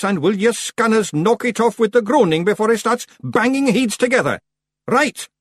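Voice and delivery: high, loud, excitable Scottish figure; Rob Anybody lecturing. A narrator's reading. Rob explaining courtship to groaning Feegles, exasperated lecture.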